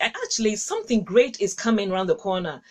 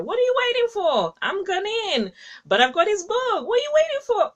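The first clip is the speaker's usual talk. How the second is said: excited urging; 10 semitones higher, faster than usual. Real, not acted. Host urging viewers to buy the book, excited high challenge. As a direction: push them to act now, voice leaping up.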